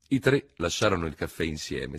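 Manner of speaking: ordinary delivery, nothing marked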